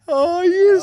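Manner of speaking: high squeal through laughter; drawn-out, very high laughing wail; helpless laughter. Real, not acted. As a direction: squeeze the words out while cracking up.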